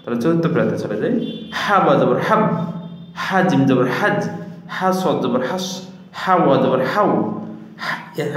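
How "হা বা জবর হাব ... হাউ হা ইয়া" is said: measured chant-like letter drill, pitched up; spelling-drill recitation. Shown as a demonstration. Teacher reads the chart aloud for learners, reciting. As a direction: spell each syllable out in a lilting drill.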